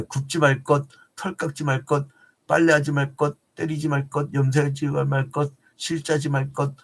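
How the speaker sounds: quick, monotone rattling-off; hurried recital of a long list; rapid-fire list. Real, not acted. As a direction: race through the rules on one level pitch. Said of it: "제가 빨리 얘기해요".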